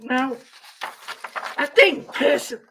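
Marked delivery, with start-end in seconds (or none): 1.6-2.6 voice cracking. Real, not acted. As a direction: pitch jumping, voice breaking. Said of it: "It's really going up and down".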